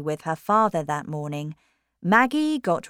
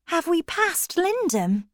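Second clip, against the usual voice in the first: put-on uneasy high young woman; 12 semitones higher than usual. Maggie asks uneasily.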